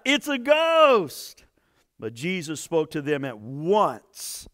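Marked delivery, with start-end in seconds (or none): 0.0-1.4 higher, louder, slow terrified cry. A narrator's reading. Disciples in the storm see a figure, terrified cry. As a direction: draw it out like men seeing a ghost. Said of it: "they cried out".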